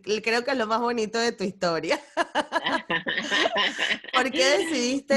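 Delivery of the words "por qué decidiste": a warm laugh breaking into the question; laughing, higher and fond; laughing while asking. Real, not acted. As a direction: ask through an amused giggle.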